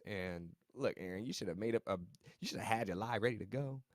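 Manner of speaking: normal talking voice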